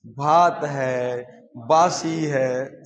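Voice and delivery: each word drawn out, very slow; proud slow listing. Retold or imitated. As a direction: savour every name, slowly. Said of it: "उससे अच्छा तो हम लोग बोलते हैं".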